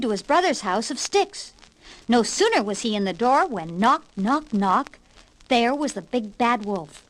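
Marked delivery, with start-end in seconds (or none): none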